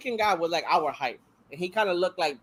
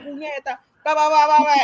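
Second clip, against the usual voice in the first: mock Spanish rant; 8 semitones higher, 4 dB louder than usual. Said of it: "This bitch is like".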